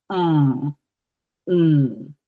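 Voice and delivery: "On, un" nasal with N left open; nasal N sound. Shown as a demonstration. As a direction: hum "On, un" in the back of the throat. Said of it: "existing in my tonsils".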